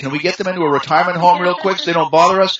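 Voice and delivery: mock the impatience of grown children; mocking modern families.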